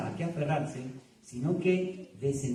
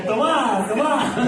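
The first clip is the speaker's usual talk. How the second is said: calling out; 8 semitones higher, 7 dB louder, much slower than usual. Retold or imitated. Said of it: "puede decir la Federica".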